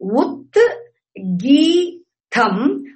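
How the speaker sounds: "Ut, gi, tham" spaced out one by one; syllable by syllable. Shown as a demonstration. Teacher counting out three syllables for students, syllable by syllable. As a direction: lift "gi" between separate "Ut" and "tham". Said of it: "are three syllables".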